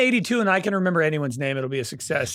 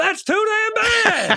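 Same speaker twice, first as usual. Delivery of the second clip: high, slow, hammed-up shout; movie-quote impression; slower than usual. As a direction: holler it high and drawn out like a catchphrase. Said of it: "you guys fucking quote that".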